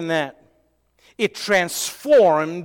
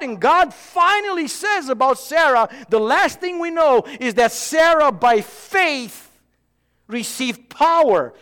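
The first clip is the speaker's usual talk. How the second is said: preaching shout; 7 semitones higher than usual. Real, not acted. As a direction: build it up and proclaim. Preacher builds to climax.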